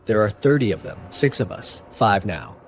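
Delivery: plain reading voice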